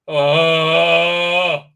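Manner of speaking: sudden loud exclamation; loud startled oh. Real, not acted. Reviewer startled by something on screen, loud exclamation. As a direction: blurt out in surprise.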